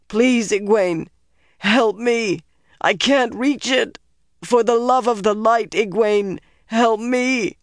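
high, pained plea for help; anguished high cry; desperate begging. Acted. Trapped man begs for help.

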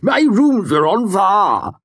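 slow, high mock shout in Vintish accent; Ambrose imitation, Vintish accent. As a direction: wail it in an exaggerated Vintish accent. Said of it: "fair imitation of Ambrose's vintage accent".

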